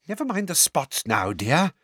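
put-on teacher impatient with the silent girl. A narrator's reading. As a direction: brush the spots aside impatiently. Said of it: "said Umbridge impatiently".

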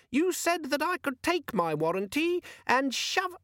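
very high, slow, squeaky indignant voice; aggrieved little gadget; angry demon. A narrator's reading. Organizer demon complaining to Vimes, squeaky and angry.

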